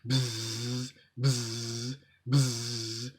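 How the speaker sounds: quiet mock bee drone; bee buzz. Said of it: "A bee buzzed".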